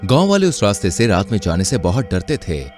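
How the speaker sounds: usual speaking voice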